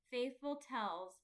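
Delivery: usual speaking voice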